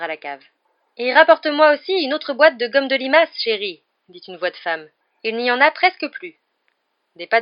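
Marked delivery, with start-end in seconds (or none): 1.0-3.8 loud, high woman calling downstairs; raised voice, pitched high. A narrator's reading. Woman calls to man going downstairs. 5.2-6.4 high, loud call to someone downstairs; raised high voice. A narrator's reading. Woman calls to man going downstairs.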